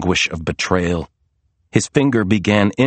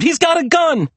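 very high, alarmed cry; screamed warning. A narrator's reading. Maria warning Serena of the gunman, screamed warning. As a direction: sound the alarm frantically. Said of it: "Maria screamed".

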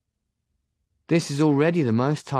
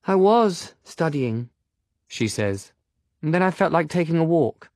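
put-on young woman voice; 7 semitones higher, 3 dB louder than usual. Agatha voiced, higher.

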